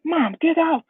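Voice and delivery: high-pitched, whiny brush-off; retold boy shooing his mother; upset protest. Crying Deku telling mom to leave, upset.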